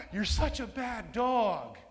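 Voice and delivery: high, loud, slow gushing praise; gushing baby talk to a dog; happy praise voice. Shown as a demonstration. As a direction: sound thrilled with the dog while the words scold it. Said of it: "it's all about the emotion".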